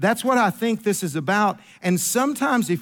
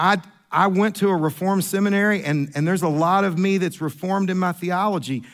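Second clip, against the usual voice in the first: ordinary talk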